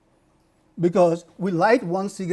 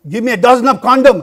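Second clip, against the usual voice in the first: much louder and higher, unashamed; loud and proud; 7 semitones higher, 15 dB louder than usual. Retold or imitated. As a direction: call it out for all to hear. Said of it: "today you will go and say".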